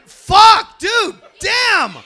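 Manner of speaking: loud frustrated outburst; frustrated shout. Real, not acted. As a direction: yell at yourself for slipping. Frustrated at losing his place.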